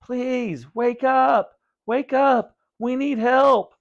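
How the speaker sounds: high, loud pleading; desperate cry. A narrator's reading. Vinny begging his parents to wake, high loud cries. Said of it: "Vinny cried".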